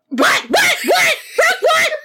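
very high, loud staccato mock yelps; cat-like shriek. Imitating Nikki told who's in the room, shrieking. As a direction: yelp it like a startled cat. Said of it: "That's what you would sound like".

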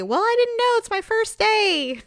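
mock helpless whine. Friend quoting the flustered parent from Mister Mom. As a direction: plead innocence like a newbie.

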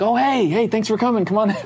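high-pitched, welcoming hello; friendly greeting. Retold or imitated. Film director welcoming a nervous comedian at the door, high and friendly. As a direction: greet a visitor in a lifted, friendly voice. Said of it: "he's very friendly".

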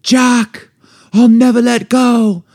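high, slow tearful heroine; hammed-up movie heroine vow; Kate Winslet. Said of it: "Kate Winslet".

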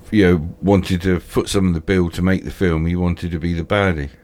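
normal talking voice

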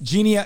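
Genii with a rising, insistent lift; Genii, said as a correction. Real, not acted. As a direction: press Genii back at him, sure you're right. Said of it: "Look up genii".

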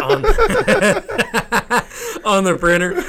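high burst of real laughter; laughing. Real, not acted. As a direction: laugh through the words. Laughs mid porn story.

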